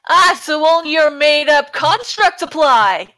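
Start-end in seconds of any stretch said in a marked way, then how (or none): none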